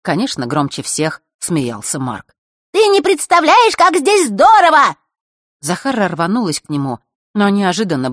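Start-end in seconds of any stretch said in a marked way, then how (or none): 2.7-5.0 high squeaky mocking girl voice. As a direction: squeak it to mock her. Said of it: "песклявым голосом". (писклявый дразнящий голос)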